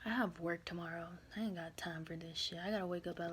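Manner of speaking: soft whispered grumble. Real, not acted. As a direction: mutter it under your breath, fed up. Tired aside, wrapping up.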